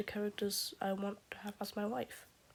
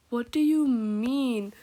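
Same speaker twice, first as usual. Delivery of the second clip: loud, drawn-out, higher-pitched outcry; indignant disbelief; 4 semitones higher, 12 dB louder, slower than usual. Real, not acted. Fan reacting to a favorite character's death, loud disbelief.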